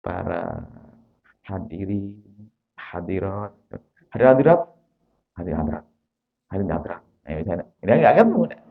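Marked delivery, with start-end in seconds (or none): 0.0-3.6 slow with pauses. Shown as a demonstration. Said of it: "Kepada, berhenti aja". 4.1-4.6 rushed loud. Shown as a demonstration. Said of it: "berhenti aja". 5.4-7.0 slow with pauses. Shown as a demonstration. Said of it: "berhenti aja".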